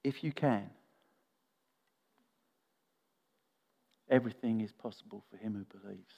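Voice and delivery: hushed, gentle and patient; real gentleness. Acted. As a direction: reassure gently, leaving space between phrases. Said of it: "this is said with real gen-, gentleness to the father".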